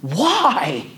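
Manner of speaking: put-on very high, loud shocked little boy; indignant child. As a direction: squeak it out in childish outrage. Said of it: "my son got indignant".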